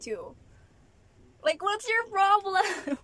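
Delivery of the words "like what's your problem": high, drawn-out exasperated question; exasperated challenge. Real, not acted. Vlogger challenging those who question her rice eating, exasperated. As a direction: stretch it out slowly in raised-pitch exasperation.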